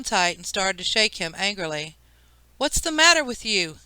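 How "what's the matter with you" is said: higher, scolding outburst; a mother snapping at her son; angry shout. A narrator's reading. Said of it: "she shouted".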